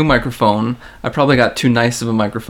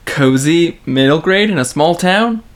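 ordinary delivery

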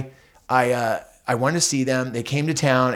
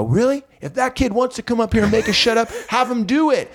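challenging; 7 semitones higher than usual. Retold or imitated. Balcony guys challenge the kid.

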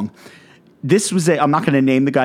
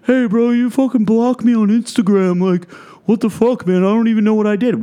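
mocking gym bro; 10 semitones higher than usual. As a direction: ham up an offended guy at an expo, pitched way up. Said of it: "they're gonna be like".

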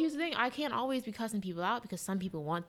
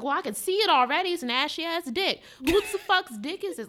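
much higher and louder, laughing at the end; disgusted outrage; 10 semitones higher, 9 dB louder than usual. Retold or imitated. Reacting to an airdropped picture, disgusted shriek. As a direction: shriek in mock horror. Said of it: "I was like".

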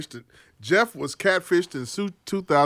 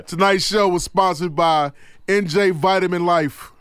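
slow, measured ad read; sponsor read; slower than usual. Acted. Host to listeners naming tonight's sponsor, ad-read delivery. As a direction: announce the sponsor slowly.

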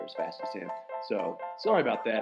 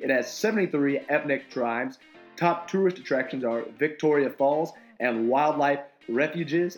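announcer voice; 5 semitones lower, 10 dB quieter than usual. Acted. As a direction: punch it like a radio announcer. Announcer insert for fun facts.

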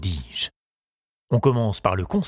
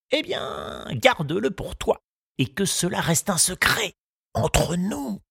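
lightly voiced old gardener, solemn and pitched up; 6 semitones higher than usual. The gardener telling Tistou the news, with a light old-man voice. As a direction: deliver the verdict gravely, a hint of an old man.

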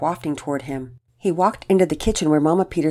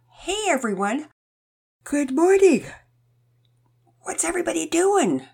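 sleepy; 12 semitones higher than usual. Acted. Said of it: "his voice sounded sleepy".

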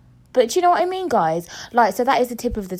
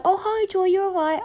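high-pitched mock friendly greeting; chirpy acquaintance; 10 semitones higher than usual. Someone greeting her on the street, mock chirpy. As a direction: imitate an over-friendly passer-by, pitched up high. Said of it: "they're like".

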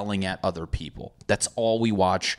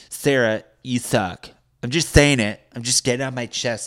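put-on pot-stirring girl; 4 semitones higher, 5 dB louder than usual. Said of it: "a girl that likes to stir the pot".

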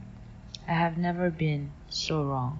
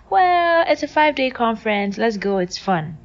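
high, loud cheery mom voice; coaxing mom; 5 semitones higher, 7 dB louder than usual. Retold or imitated. Mom urging daughter to a conference, high and cheery. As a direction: sell it like it's a treat.

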